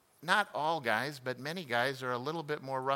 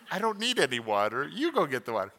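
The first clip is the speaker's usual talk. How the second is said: laughing retort; 5 dB louder, faster than usual. Retold or imitated. Husband voicing wife's laughing retort.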